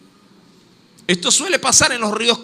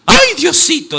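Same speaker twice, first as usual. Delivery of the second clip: high-pitched cry of fright; acted yelp at something unexpected; startled exclamation; 8 semitones higher, 4 dB louder than usual. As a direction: exclaim it high, as if caught by surprise. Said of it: "exclamación de un susto de algo inesperado".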